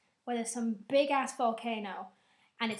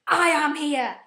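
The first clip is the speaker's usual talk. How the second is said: higher, much louder dramatic villain entrance; menacing villain; 4 semitones higher, 10 dB louder than usual. Retold or imitated. Benedict Cumberbatch revealing himself, hammed-up villain. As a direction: announce yourself like a villain. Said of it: "just like".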